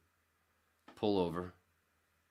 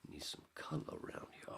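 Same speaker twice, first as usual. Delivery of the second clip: whisper; 3 semitones lower, 9 dB quieter than usual. Retold or imitated. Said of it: "And I says".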